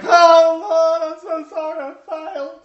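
high-pitched whimpering plea, hammed up; mock wailing apology. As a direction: wail a fake, pathetic confession on a high note. Mock plea before the Father's throne.

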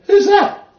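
high laughing squeal of disbelief; who on earth is that, laughing; shocked laughing cry; laughing surprise. Retold or imitated. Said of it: "I thought".

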